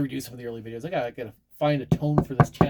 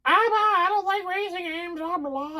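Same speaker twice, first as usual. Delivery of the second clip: much higher, whiny dismissive mock voice; mocking his past self. Mocking his younger self who scorned racing games, in a high whiny voice. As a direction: sneer it like a snobby younger you. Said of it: "I'm like".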